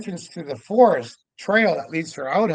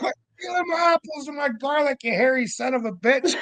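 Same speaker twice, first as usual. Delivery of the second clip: mock scolding yell; 11 semitones higher than usual. Yelling at the Sasquatch.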